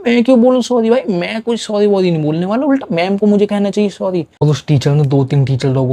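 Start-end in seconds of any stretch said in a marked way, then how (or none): 0.1-4.3 defiant refusal. Retold or imitated. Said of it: "मैंने कहा".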